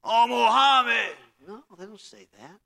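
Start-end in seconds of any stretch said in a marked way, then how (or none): 0.0-1.2 slow, loud exasperated swearing; hammed-up angry oath, drawn out and loud; mock angry curse. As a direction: bellow the name like a curse in mock frustration. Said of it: "You don't hear people going and say".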